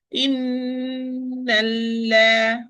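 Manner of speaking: Inna la chanted very slowly, the lam long and doubled; Quranic recitation. Shown as a demonstration. Teacher recites for her student, very slow chant. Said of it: "give the time of the first lam".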